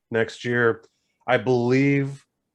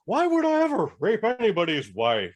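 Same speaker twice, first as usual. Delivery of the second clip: mock innocent Adam Driver; 10 semitones higher than usual. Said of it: "Adam Driver is like".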